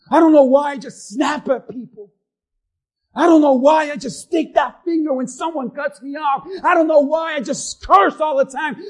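acted frustration rising to a loud outburst; angry confession. Pastor voicing an angry churchgoer, building to a shout. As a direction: vent like someone who can't stop snapping. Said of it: "Maybe that's you".

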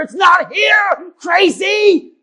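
loud, high exasperated shout; hammed-up shout. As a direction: yell it in disbelief. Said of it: "I'm getting murdered".

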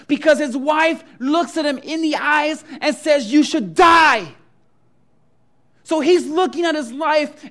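loud, high, impassioned preaching; rising sermon fervor, pleading as Job; preaching at full force. Acted. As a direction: thunder it from the pulpit.

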